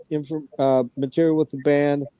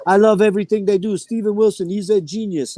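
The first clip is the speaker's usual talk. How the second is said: higher, quick imitation of a French Canadian; French Canadian accent; 6 semitones higher than usual. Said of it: "That's my French Canadian".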